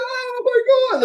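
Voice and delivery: very high acted shriek of disbelief; astonished high-pitched wail; shocked spectators. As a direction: cry out as a stunned crowd. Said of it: "Like, nobody expect".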